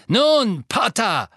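high loud sneering call; taunting shout. A narrator's reading. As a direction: jeer at him before striking. Death Eater taunts Harry.

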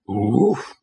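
short voiced woof; a little pleading dog noise; begging dog. A narrator's reading. Simple dog answers his companion, begging. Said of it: "said Laddie, begging".

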